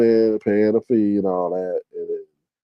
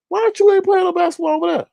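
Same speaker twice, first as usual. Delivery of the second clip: incredulous; faster than usual. Retold or imitated. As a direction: squeal like you can't believe your eyes. Same confrontation continued.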